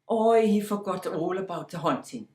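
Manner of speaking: ordinary delivery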